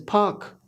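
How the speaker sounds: फाक with a puffed P and no R; correct park. Shown as a demonstration. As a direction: say park as फाक, aspirated, long aa. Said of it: "जो right sound है".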